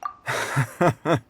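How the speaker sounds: amused chuckle. Acted. Amused by the boast.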